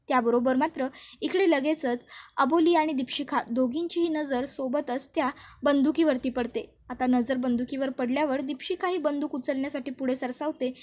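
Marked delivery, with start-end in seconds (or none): none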